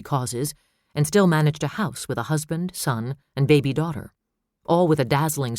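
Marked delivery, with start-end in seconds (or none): none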